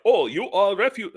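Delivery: a hint of a mock German official; attempted German accent. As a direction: try a German accent and give up. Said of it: "I can't do a German accent".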